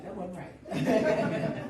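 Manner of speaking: very slow, laughing self-correction; slowed-down admission. Retold or imitated. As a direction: drag it out, laughing at yourself. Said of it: "slow down and be like".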